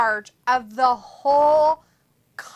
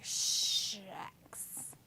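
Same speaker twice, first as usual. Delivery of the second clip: whisper; 5 semitones lower, 14 dB quieter than usual. Retold or imitated. Whispered quote in chat reading.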